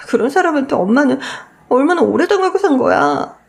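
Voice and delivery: put-on a hurt girl crying out at injustice; tearful daughter. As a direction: daughter hurt and upset after grandmother's insult. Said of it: "미애가 울면서 나오는 게 보였죠".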